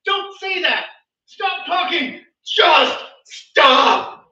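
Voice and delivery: high, loud shouted commands, building to a final yell; screaming voice of reason. Acted. Voice of reason screams at the stumbling tongue, shouted. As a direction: scream it as escalating orders. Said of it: "the voice of reason screams".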